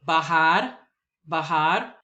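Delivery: bajar as ba-HAR, j like h; bajar, rising at the end; Spanish word. Shown as a demonstration. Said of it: "words that I will pronounce".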